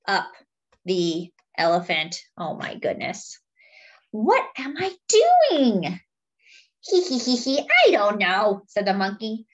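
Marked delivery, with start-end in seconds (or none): 2.4-3.4 soft unvoiced whisper. Storyteller hushing the kids, whispered. Narrator aside. 4.1-6.0 lightly voiced dopey elephant, slower, louder start; forgetful elephant. Fred the elephant agreeing with Monkey, lightly voiced and muddled. 6.8-8.6 lightly voiced sly monkey, a bit higher; cheeky monkey. Monkey teasing his elephant friend, lightly voiced and sly.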